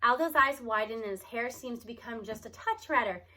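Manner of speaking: plain reading voice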